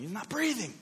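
high-pitched mock yell of alarm; urgent, high cry, held back; retold yell. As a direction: re-enact panicked yelling without full volume. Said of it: "I start yelling".

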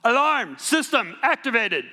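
high, loud, slow machine announcement; robot alarm voice. Retold or imitated. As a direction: mimic a robotic alarm system. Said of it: "Super loud".

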